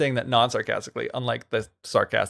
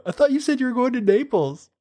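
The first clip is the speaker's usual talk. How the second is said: high, put-on innocent voice; wife teasing the returning prince; mock innocent tease; 11 semitones higher than usual. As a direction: tease him sweetly.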